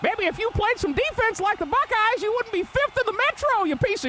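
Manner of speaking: high-pitched mock trash talk. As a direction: taunt like a squeaky cartoon jock.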